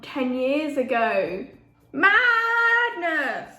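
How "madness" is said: high, loud, drawn-out exclamation; loud cry of amazement; amazed outburst. Real, not acted. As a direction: cry out in disbelief, stretching the word. Amazed at ten years passing.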